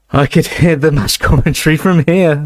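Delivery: laughing through the words; shaking with laughter. A narrator's reading. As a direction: voice trembling with giggles. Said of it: "his voice now shaking with laughter".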